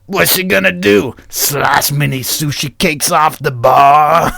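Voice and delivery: loud, drawn-out jeer into cackle; mocking biker. Biker mocking the sword woman, loud slow jeer. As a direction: jeer it out, then laugh.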